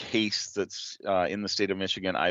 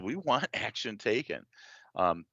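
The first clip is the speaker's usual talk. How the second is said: usual speaking voice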